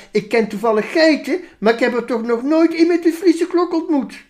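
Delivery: dry sheep. Acted. Sheep Veronica correcting the minister, smug and high. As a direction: correct him smugly.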